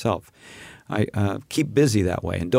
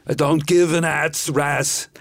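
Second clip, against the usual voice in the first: put-on slow Austrian accent, laughing through the slip; a fumbled Austrian-accented line, slow; Arnold Schwarzenegger; 3 semitones higher, slower than usual. Mock Austrian accent for a podcast bit, slow. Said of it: "Arnold Schwarzenegger".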